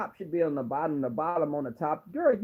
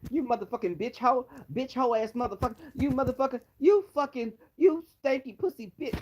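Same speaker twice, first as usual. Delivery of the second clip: mock cursing; 7 semitones higher, faster than usual. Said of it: "started cursing".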